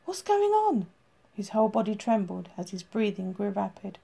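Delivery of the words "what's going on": much higher, frightened question; alarmed. Acted. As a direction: panic breaking in. She runs to him alarmed.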